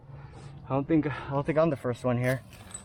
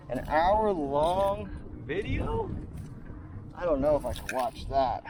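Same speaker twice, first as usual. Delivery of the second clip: incredulous viewer; 2 semitones higher, slower than usual. Retold or imitated. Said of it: "they're gonna go".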